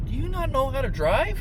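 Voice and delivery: high, exasperated yell at driver; road rage. Real, not acted. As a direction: scold the driver in disbelief. Ranting at drivers in traffic.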